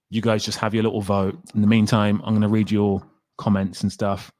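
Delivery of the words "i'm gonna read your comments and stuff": normal talking voice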